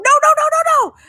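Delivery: high frantic yelling of no; panicked no. Retold or imitated. Owner stopping cat dragging kitten, frantic high yell. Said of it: "I was like".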